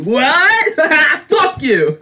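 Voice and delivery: high, loud mock-heckler shout; drunk heckler. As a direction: yell it like a drunk jeering at a vendor. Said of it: "just drunk ass motherfuckers from".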